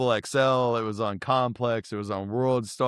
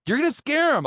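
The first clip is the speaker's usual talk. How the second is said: high-pitched, alarmed scolding of a friend; retold warning; 4 dB louder than usual. Warning a friend about the picture, high retold alarm. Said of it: "And I was like".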